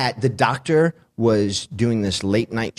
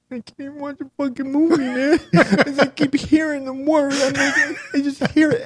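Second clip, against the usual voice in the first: laughing. Real, not acted. Laughing retelling movie frustration.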